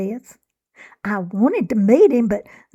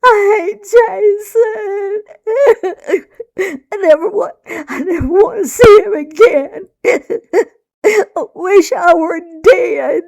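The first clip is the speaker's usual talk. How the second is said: high, loud sobbing shriek; 10 semitones higher, 8 dB louder than usual. A narrator's reading. Hysterical girl shrieking to Mama, crying. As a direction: shriek it through hiccups and tears. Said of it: "I could only shriek".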